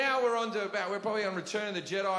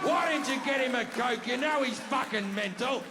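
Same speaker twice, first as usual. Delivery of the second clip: higher mock scolding; exasperated, raised-pitch parental reproach; blaming mother; 4 semitones higher than usual. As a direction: imitate a mother turning the blame on you. Mother blames the narrator.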